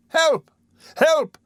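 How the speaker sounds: Oliver screams for help in the man's grasp, desperate; cry for help. A narrator's reading. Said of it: "cried Oliver, struggling".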